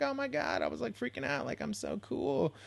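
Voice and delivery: mock panic. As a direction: replay your own panic, hammed-up. Retelling own panic over guests.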